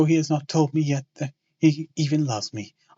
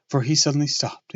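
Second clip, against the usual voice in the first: ordinary delivery, nothing marked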